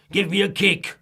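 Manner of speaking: loud grumpy snap; snapped. Acted. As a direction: bark at the cake seller. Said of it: "snapped Mister Grumpy".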